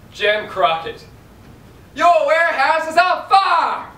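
loud, high Southern gangster cry; a Southern accent on a shouted alarm. Acted. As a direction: yell it with a Southern drawl.

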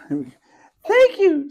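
tiny whispered squeak; squeaky crab. Acted. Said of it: "squeaked the crab".

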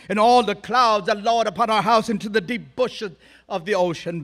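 loud, grand stagey declaiming; speechifying Shakespeare. Retold or imitated. Teen actor showing off his speech training, grand loud declaiming. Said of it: "I was speechifying all the livelong day".

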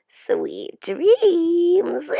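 high, mocking witchy goodnight with a cackle; cackling. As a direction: cackle it like a witch sending them to sleep. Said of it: "she cackles".

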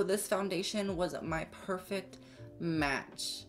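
ordinary talk

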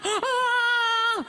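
high crying wail; fake wail. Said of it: "Pourquoi tu pleures comme ça?".

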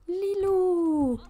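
high, loud delighted call of the name; excited call. Real, not acted. Player calling to her Sim, loud and high.

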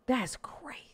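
breathy whispered aside. Real, not acted. Co-host whispering disbelief at the comedian's remark. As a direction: whisper it to yourself.

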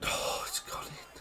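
whispered in disbelief; real dismayed whisper; stunned whisper. Real, not acted. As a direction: breathe it out, crushed. Conceding a goal.

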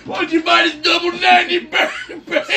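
high, loud pitch breaking into laughter; pushy salesman shout. Acted. Counter guy upselling a customer, laughing shout. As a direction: shout the upsell, barely holding back the laugh.

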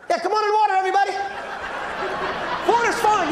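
high, bright-toned announcer calling swimmers in; mock beach lifeguard. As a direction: pitch it way up like a chirpy lifeguard.

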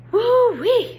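high, loud delighted woo; excited whoop. Acted. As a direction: whoop at the wild storm. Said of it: "said Gloria".

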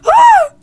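very loud, high shriek; frightened scream. Real, not acted. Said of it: "did you hear me scream".

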